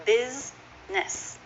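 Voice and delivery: slow "Business", BIZ, pause, ness; two syllables. Shown as a demonstration. Teacher sounding it out, "Business" slowly as biz-ness. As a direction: split "Business" into two parts. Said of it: "That is a two-syllable word".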